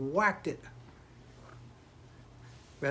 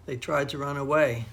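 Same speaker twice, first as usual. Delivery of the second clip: plain reading voice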